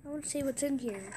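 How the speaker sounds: usual speaking voice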